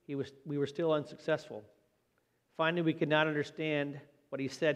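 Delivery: usual speaking voice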